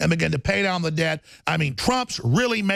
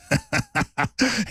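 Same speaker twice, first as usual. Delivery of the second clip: mocking laugh; 4 semitones higher than usual. Real, not acted. Mocking beaten enemies.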